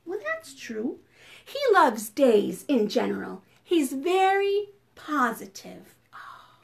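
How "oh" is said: ordinary delivery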